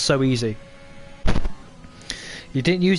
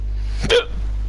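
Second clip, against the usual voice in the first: a real, rough coughing fit; harsh cough. Real, not acted. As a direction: cough hard, away from the mic. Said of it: "Oh, that was a bad one".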